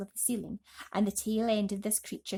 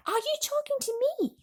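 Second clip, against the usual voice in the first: put-on much higher surprised boy voice; James. A narrator's reading. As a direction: ask it startled and high. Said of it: "James asked him".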